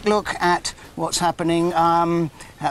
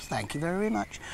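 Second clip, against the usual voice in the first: patronising; 9 dB quieter than usual. Real, not acted. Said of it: "that sounded ever so patronising".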